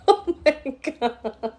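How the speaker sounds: breaking into laughter while crying, pitch jumping up; real laugh-cry, overcome with emotion; laughing through tears. Real, not acted. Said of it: "I'm just feeling everything right now".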